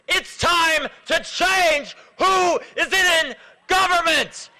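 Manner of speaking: real anger, climbing into a rallying cry; angry. Real, not acted. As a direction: drive each phrase home with rising outrage. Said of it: "I'm so angry".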